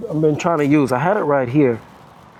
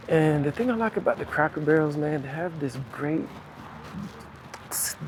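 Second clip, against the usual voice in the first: hushed voice; 10 dB quieter than usual. Real, not acted. Talking low inside the restaurant.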